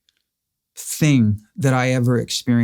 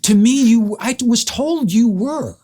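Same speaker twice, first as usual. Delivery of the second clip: much higher, slow and drawn out in disbelief; incredulous protest; 10 semitones higher, slower than usual. Real, not acted. To his friend who says he is not dead, pitched-up disbelief. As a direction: protest that he really was dead to him.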